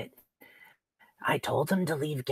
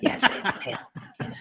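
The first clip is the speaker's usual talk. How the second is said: whisper; 3 semitones higher than usual. Real, not acted. Teasing whisper while sick.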